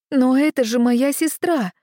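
loud, high excited outburst; excited exclamation. A narrator's reading. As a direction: burst out, jumping up. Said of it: "возбуждённо воскликнула я". (возбуждённо воскликнуть)

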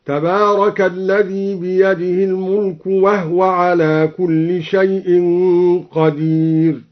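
وهو said wahw with still ha, slow chant; slow recitation, وهْو without vowel; recited with silent ha. Shown as a demonstration. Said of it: "سكن الهاء هكذا". (ترتيل بتسكين الهاء في وهو)

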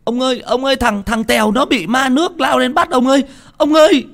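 high, frantic shouting; children shouting. Acted. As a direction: yell while running away in fear. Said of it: "vừa chạy vừa hét".

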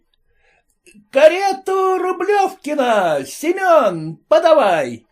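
high, slow doorman's shout; lackey yells for the coachman, high and drawn out. Acted. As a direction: bellow the carriage call across the yard. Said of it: "закричал лакей".

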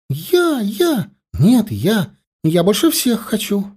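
put-on high, eager kids' voices; clamouring children. Children compete for ice cream, clamouring in high voices. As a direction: squeal over each other for a treat.

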